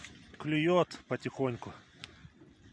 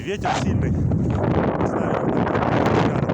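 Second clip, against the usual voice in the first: gusty wind roaring over the mic; talking outdoors into strong wind; 10 dB louder, slower than usual. Real, not acted. Wind noise, outdoors on ice.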